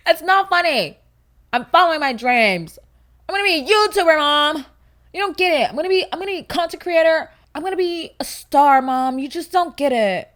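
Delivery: put-on high, loud teenage-style outburst; dramatic protest. Misunderstood kid telling mom about YouTube dreams, dramatic protest. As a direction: plead and protest, hammed up.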